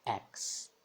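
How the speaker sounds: X dito como KS, sem voz; som inglês do X. Shown as a demonstration. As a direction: solte o X como "écs". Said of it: "sempre o som de X". (X com o som inglês de KS)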